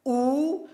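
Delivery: "oo" — протяжный гласный звук из through; isolated vowel. Shown as a demonstration. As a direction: sound out "oo" on its own. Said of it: "So we have through".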